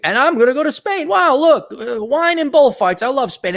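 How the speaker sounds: high, giddy voice of a dazzled traveller; mock excitement over wine and bullfights; mock gushing tourist. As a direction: ham up the wide-eyed enthusiasm, pitched high. Mocking an imagined traveller's enthusiasm.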